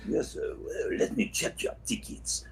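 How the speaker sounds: soft breathy French guard, Pierre the spider; a hint of a French accent, almost whispered. Pierre the French spider guard checking tickets, light French accent. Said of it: "Pierre has a French accent".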